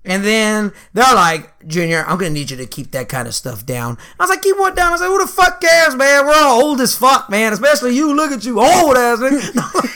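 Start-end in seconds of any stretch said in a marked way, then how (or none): 1.7-4.0 low, contained mock reprimand; reproving relative. Relatives asking Junior to tone it down, low reproof. 4.4-9.9 high-pitched mock outrage; defiant comeback. Junior answering relatives who object to jokes, defiant protest.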